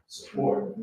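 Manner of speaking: normal talking voice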